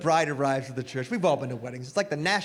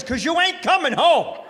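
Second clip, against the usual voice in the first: gruff dad warning; 11 semitones higher, 7 dB louder than usual. Retold or imitated. Dad to son before the wedding, loud mock warning. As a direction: bark it like a stern father. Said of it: "He says".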